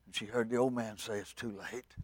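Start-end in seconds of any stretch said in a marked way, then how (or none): 1.2-2.0 very soft, grave finality; quiet, resigned verdict; solemn refusal. Acted. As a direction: answer the plea in a hush. Noah answers Mama solemnly.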